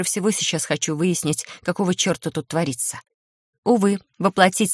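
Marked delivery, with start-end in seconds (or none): none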